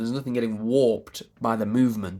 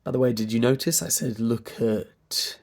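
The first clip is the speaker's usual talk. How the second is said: normal talking voice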